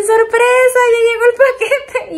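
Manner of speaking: high, loud sing-song surprise; teasing friends' high-pitched surprise call; mock surprise shout. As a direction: imitate friends yelling surprise. Friends' surprise message acted out.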